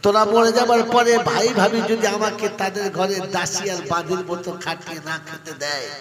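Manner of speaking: soaring preacher's wail; full-force preaching. Acted. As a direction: hurl it out to the whole crowd.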